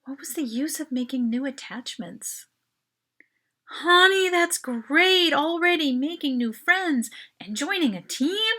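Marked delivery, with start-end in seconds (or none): 3.6-8.5 high, loud, bright praise; encouraging. A narrator's reading. Mom praising Mel at dinner, high and loud. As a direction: gush with motherly pride. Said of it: "Her mom encouraged it".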